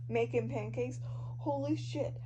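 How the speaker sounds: normal talking voice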